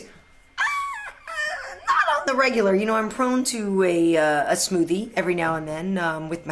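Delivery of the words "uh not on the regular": bursting into a high laugh. Real, not acted. Host laughs answering viewer's juicing question, laughing.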